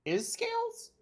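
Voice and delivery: surprised. Real, not acted. Surprised question.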